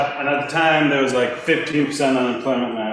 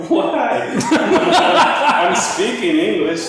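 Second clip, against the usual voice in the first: laughing protest; 10 semitones higher, 6 dB louder, slower than usual. Retold or imitated. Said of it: "And I'm like".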